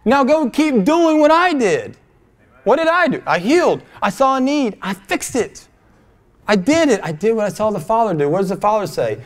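high, forceful sermon delivery; impassioned preaching. Acted. Preacher recounting what the Father does, impassioned. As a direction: preach it with rising fervor.

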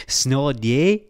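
exaggerated Toronto accent, rising snow day; doing a Toronto character's snow day. Imitating a Toronto character's accent. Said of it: "it's Toronto accent".

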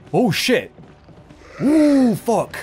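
loud high yelp of fright; startled shout. Real, not acted. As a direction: jump out of your skin and shout. Sudden scare by zombie.

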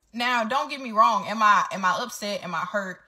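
ordinary talk, nothing marked

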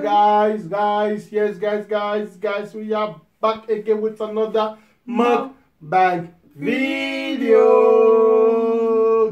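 loud, high, stretched calls to the viewers; excited hype. Acted. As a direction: pump up the crowd before the meal. Hyped channel intro continues.

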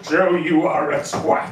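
slow, deadpan dad insult; dad's insult. Retold or imitated. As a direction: drag it out, dry and blunt. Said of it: "he went".

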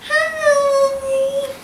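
squeaky little toy voice; high puppet voice. Pretend. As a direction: greet the kids as a tiny puppet. Puppet says hi to children.

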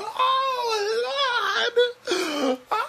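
high hammed-up wail of grief, half laughing; mock wailing cousin. Imitating the cousins wailing into the hospital room. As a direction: mimic a relative wailing over and over. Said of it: "you seen the cousin them coming down".

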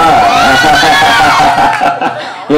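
long burst of open laughter. Real, not acted. Host reacting to fans' song request, laughing. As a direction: laugh in quick repeated pulses.

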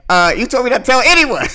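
high, loud mock-outraged retort; mad, imitated. Dan gets mad over the secret, loud mock outrage. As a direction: throw it back in hammed-up anger. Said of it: "when he was getting all mad".

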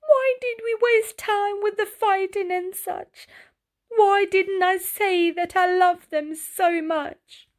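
high, tearful snail voice; crying regret. A narrator's reading. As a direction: sob it out, missing his brothers. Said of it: "that made him so sad".

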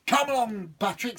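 high, hurrying devil voice; impatient devil. Acted. Devil hopping, urging Patrick to hurry. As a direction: hurry him along.

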